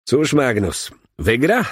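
a touch of lisp, rising on "wygra"; lisped question. A narrator's reading. Maliński asking the jockey about Magnus, lightly lisping. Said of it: "zaseplenił".

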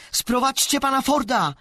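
high, loud call for help; urgent loud summons. Acted. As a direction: shout across the classroom for help. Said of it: "zawołała Alisa".

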